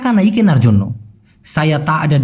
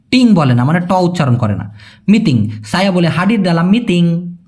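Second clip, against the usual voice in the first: ordinary talk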